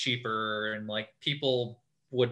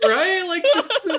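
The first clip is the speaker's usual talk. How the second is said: much higher, loud laughing squeal; 8 dB louder, slower than usual. Real, not acted. Host squeals with laughter about the power move.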